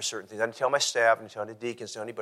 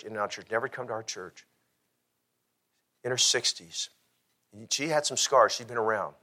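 usual speaking voice

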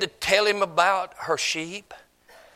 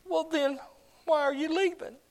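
lightly voiced bride, high and puzzled; 11 semitones higher, 5 dB quieter than usual. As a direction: ask it softly in a higher voice. Bride asks shepherd why leaving.

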